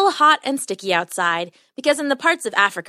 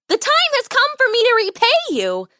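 very high-pitched little mouse voice; squeaky mouse; 14 semitones higher, 3 dB louder than usual. A narrator's reading. Mouse come to repay the lion, squeaky. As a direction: squeak it out proudly like a tiny mouse. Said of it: "a squeaky voice said".

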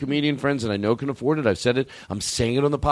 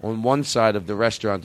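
usual speaking voice

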